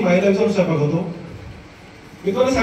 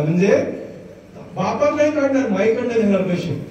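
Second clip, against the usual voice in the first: normal talking voice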